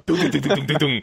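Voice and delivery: imitated train noise. Podcaster jokes about trains passing in the tunnel, imitated train noise. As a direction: mimic an oncoming train rattling past. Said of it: "när de här mötande tågen kommer".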